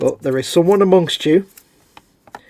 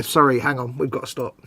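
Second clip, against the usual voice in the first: ordinary talk, nothing marked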